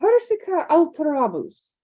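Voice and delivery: a brisk Scottish professor demonstrating; "Persica alteramus" with weight on PER and AL; McGonagall's spell. Acted. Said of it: "Emphasis on first syllables only".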